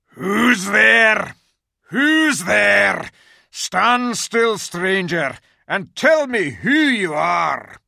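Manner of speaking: loud, high, growling beast shout; growling wolf. A narrator's reading. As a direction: bark it out like a guard wolf. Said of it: "said in a growling voice".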